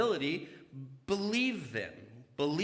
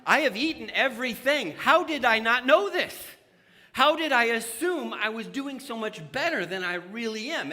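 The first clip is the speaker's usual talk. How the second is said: rising, louder exclamations of self-disbelief; mock stunned outburst getting louder; shocked disbelief; 5 semitones higher, 7 dB louder than usual. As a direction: act out the shock at your own spending. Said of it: "and you're like".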